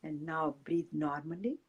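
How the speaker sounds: soft, unhurried meditation guide voice; guided meditation. Acted. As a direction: ease the listeners into calm breathing.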